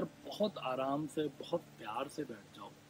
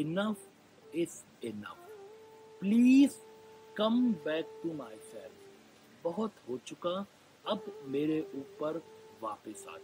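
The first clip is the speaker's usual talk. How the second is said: raised, emphatic plea with a loud, high please; earnest plea; 3 semitones higher, 6 dB louder, slower than usual. Shown as a demonstration. Meditation guide begging body and mind to come back, earnest plea. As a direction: implore it, pushing hard on please. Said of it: "प्रार्थना करता हूँ".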